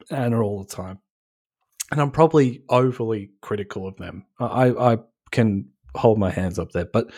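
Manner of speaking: normal talking voice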